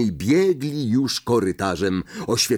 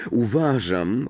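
plain reading voice

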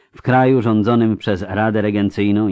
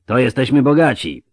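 raised, louder and higher voice; shouting over din; 5 semitones higher, 5 dB louder than usual. A narrator's reading. Rider jokes about rich bonuses over the cheering, calling out. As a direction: call it out over the noise. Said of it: "przekrzyczał Zgiełk".

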